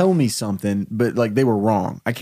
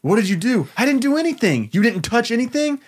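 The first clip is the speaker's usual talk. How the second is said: panicked high voice; 7 semitones higher than usual. Acted. Boy panicking in pitched story.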